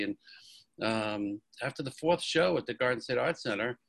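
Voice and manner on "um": usual speaking voice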